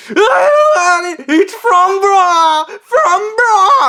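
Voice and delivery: high-pitched mock wail; incoherent screaming. Imitating him freaking out over the phone, screaming. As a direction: imitate a hysterical man screaming nonsense. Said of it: "it's just him screaming incoherent nonsense".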